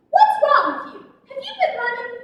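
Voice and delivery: a light, giggly mom voice; suspicious mom. Retold or imitated. Mom on the phone.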